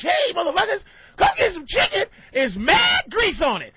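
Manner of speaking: high, loud hammed-up pitch; mock chicken ad. Mocking a chicken commercial, high and loud. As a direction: holler it like a cheesy chicken ad. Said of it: "if you do watch".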